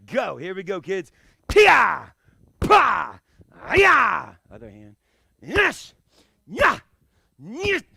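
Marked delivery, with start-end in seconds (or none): 1.5-4.3 three loud shouted kias; kia yells. Shown as a demonstration. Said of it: "vary up your kia". 5.4-7.8 three high loud shouts; kia yells. Shown as a demonstration. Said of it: "vary up your kia".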